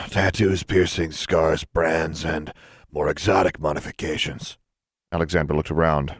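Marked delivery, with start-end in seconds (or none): 0.1-4.6 lightly voiced weary shopkeeper; a hint of gruff tedium; sighing proprietor. A narrator's reading. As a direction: list them with mild weariness. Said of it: "The man with the mohawk sighed".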